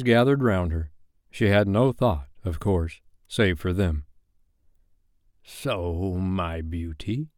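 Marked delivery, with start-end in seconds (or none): 5.4-7.3 slow, soft, oily menace; syrupy Hook. Acted. Hook gloating over captive Wendy, slow syrupy purr. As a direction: pour it out softly like syrup. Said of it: "as if he spoke in syrup".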